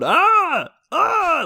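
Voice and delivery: high, loud hammed-up cries of pain; fake pained screams. Faking pain during a pretend spanking, high hammed-up screams. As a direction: yelp as if the spoon really hurts. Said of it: "screaming like I'm, I'm hurting".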